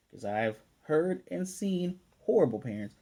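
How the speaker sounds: normal talking voice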